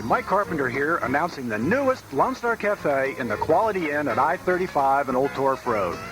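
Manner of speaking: ordinary talk, nothing marked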